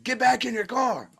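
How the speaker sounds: high, strained imitation of the cop barking an order; mock cop order. Cop orders him back into his car, mocked high.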